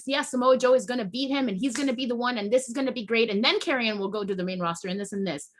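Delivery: normal talking voice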